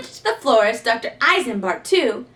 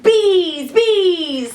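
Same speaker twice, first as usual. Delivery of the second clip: high, loud bees, bees; alarmed cry; 7 semitones higher, 6 dB louder than usual. A narrator's reading. Narrator cries out as bees chase Maisie, high and loud. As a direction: sound the alarm.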